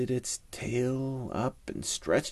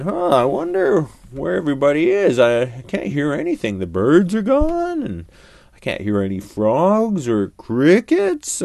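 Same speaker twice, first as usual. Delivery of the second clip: fast, louder puzzled lion; old lion voice; 7 semitones higher, 8 dB louder than usual. A narrator's reading. Lion wonders where the sounds went, fast and puzzled. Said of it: "And he said".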